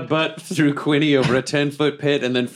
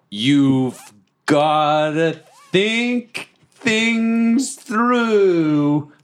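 very slow, much higher lecturing; slow preachy voice; 8 semitones higher, much slower than usual. Acted. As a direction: drag each word out like a sermon. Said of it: "And I'm like".

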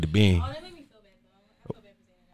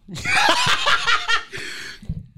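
high squealing laugh. Real, not acted. Big laugh after Scorpio line.

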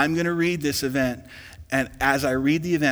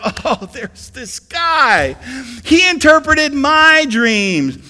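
remembering cupbearer; 12 semitones higher, 10 dB louder, slower than usual. Acted. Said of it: "The cupbearer remembers".

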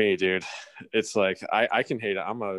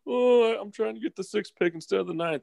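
much higher, whining coach; whiny high mock voice; 12 semitones higher than usual. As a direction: whine it like a pouting loser. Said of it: "not like".

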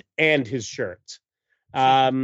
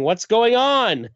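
higher-pitched, drawn-out yell; hammed-up cry of alarm, stretched out; mock yell; 9 semitones higher, 3 dB louder, slower than usual. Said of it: "he's yelling".

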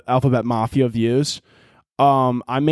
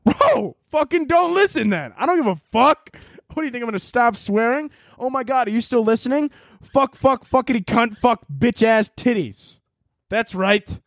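much higher, swearing in gleeful defiance; defiant rant; 9 semitones higher than usual. Real, not acted. Yelling swears back at a fan who asked him to stop, high and gleeful. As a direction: taunt the letter writer with a string of curses.

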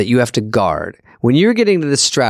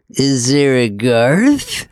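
Garth voice; much slower than usual. Pretend. At the Vanity Fair door, a slow shy Garth. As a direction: turn slowly and murmur it as Garth. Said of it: "slowly turn and go".

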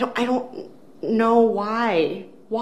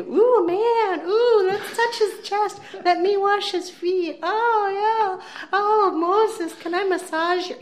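much higher, louder mock swooning girl voice; 13 semitones higher, 4 dB louder, slower than usual. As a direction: coo over him like smitten admirers. Mocking girls fawning over Moses.

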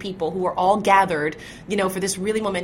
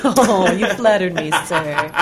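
mock coy flattery; 7 dB louder, slower than usual. Playfully coy reply to compliment.

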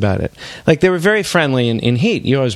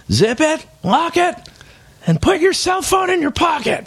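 much higher, sing-song instructions; silly high voice; 13 semitones higher than usual. Acted. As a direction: chirp the rules way up high. Reading silly robbery instructions.

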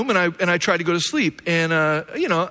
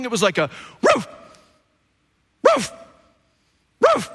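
dog bark impression. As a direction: bark like the neighbor's huge dog. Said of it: "that's my best impression of that".

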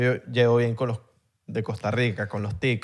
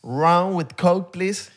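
put-on slow American-accented English order; pedido en inglés gringo; 2 semitones higher, much slower than usual. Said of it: "Tú serías buen gringo también". (acento gringo, pedido lento)

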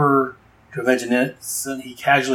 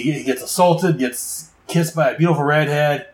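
ordinary talk

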